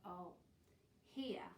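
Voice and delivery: ordinary delivery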